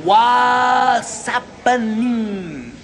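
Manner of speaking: Chicano greeting. Shown as a demonstration. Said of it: "watch this here".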